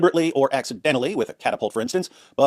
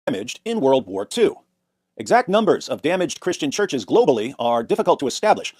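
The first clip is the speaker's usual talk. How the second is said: normal talking voice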